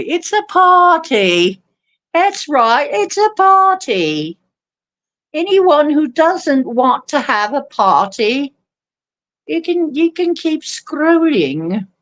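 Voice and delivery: high, hammed-up party announcement; mock-giddy hostess, pitched high; playful party cheer.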